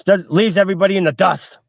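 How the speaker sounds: usual speaking voice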